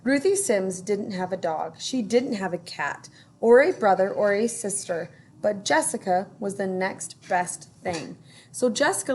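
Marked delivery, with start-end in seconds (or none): none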